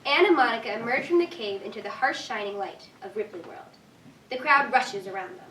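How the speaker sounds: usual speaking voice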